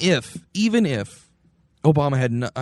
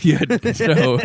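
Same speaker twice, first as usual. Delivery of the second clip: ordinary talk, nothing marked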